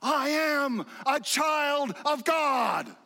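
high, drawn-out proclamation; imitated kid's yell. Preacher imitating a little kid in the neighborhood, yelling. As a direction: belt it out slowly like an excited kid. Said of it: "who just screams it from the mountaintops".

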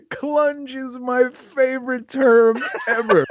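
high, slow, helpless laughter through the words; laughing hard. Real, not acted. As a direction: barely get it out for laughing. Cracking up at slang word.

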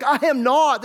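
loud, high, emphatic denial; bold denial. Acted. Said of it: "he said it so boldly".

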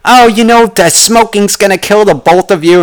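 mocking an older wife's scolding; nagging wife. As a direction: chime in like a fussing wife, high and loud. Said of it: "the wife chimed in".

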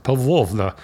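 lightly put-on Russian accent with a long O; best Russian accent. Shown as a demonstration. Showing off the princess's name, in a Russian accent. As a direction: say it in your best mock Russian. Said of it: "that was my best Russian accent".

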